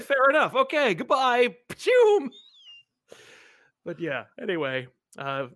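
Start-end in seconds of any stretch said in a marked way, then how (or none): 0.0-1.6 high, loud mock-cheery goodbye; hammed-up hasty farewell; awkward retreat. Acting out his hasty awkward exit. 1.7-2.3 high, loud zooming-off noise; spoken sound effect of fleeing; cartoon getaway whoosh; imitated whoosh. Imitates himself zooming away.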